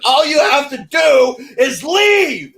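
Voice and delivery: loud, slow, high shout of all you have to do is leave; drawn-out exasperated yell. Retold or imitated. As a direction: yell it out slowly, fed up. Telling girl to leave, shouting.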